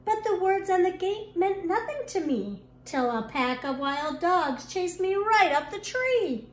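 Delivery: plain reading voice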